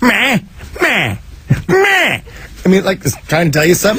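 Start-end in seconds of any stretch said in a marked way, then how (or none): 0.0-2.2 high anxious cat "meh"s; imitating the scared cat; cat meowing. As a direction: meow like a cat sensing a storm. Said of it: "It goes".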